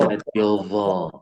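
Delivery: zo said heavy and rounded, slow; thick zo. Shown as a demonstration. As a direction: let it go dark into zo. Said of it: "kalau tebal jadi apa".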